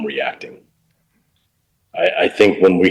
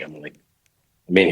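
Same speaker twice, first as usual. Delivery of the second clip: ordinary delivery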